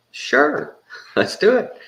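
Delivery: high, bright, laughing acceptance; giddy laughing agreement; eager laughing yes. Retold or imitated. Accepting a joint from Willie.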